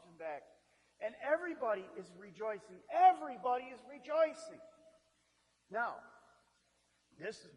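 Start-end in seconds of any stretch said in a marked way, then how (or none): 2.9-4.6 louder and higher, ringing joy; excited proclaiming. Shown as a demonstration. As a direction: proclaim it like good news. Repeated for emphasis.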